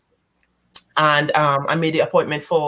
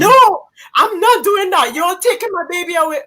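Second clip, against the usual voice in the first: high, pleading cry of refusal; distraught high-pitched protest; frantic denial; 12 semitones higher, 5 dB louder than usual. Retold or imitated. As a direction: refuse it in a panic, voice shooting up. Denial at doctor's news.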